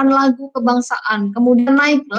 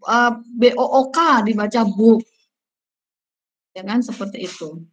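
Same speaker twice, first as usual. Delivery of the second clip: normal talking voice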